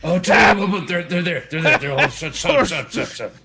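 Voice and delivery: loud, high shout of shut up; yelling. Acted. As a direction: yell shut up at a teammate.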